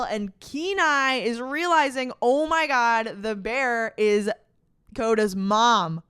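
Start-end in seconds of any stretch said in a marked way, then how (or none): 2.2-6.0 slow dawning realization. Retold or imitated. Kenai slowly realizes the truth.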